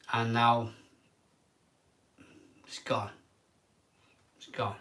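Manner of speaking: near-silent, breaking whisper of real grief; real tears, barely getting it out; choked-up crying. Real, not acted. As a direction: let the words die away in tears. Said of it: "I'm crying".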